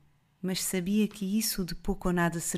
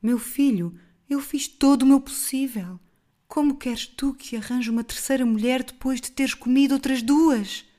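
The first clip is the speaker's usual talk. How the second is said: desesperada; 5 semitones higher, 6 dB louder than usual. A narrator's reading. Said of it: "disse-lhe desesperada".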